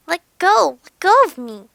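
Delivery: very loud, frantic demand; desperate shout. A narrator's reading. Narrator shakes the arm to get free, desperate shout.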